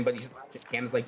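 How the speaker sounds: plain reading voice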